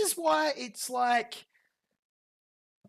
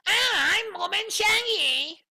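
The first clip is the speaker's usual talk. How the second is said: high, slow, mocking girly voice; mocking woman voice; 9 semitones higher, 7 dB louder, slower than usual. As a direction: sneer it out as a prissy woman, stretched slow. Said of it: "anorexic voice".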